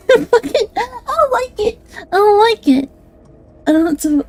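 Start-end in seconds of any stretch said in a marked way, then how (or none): 0.1-2.9 giggly whine. Real, not acted. Flustered by her own attempt.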